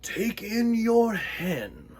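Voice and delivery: slow, drawn-out sage voice; lightly voiced old man giving slow advice; wise old man.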